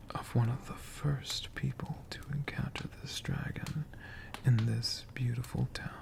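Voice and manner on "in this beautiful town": ordinary delivery, nothing marked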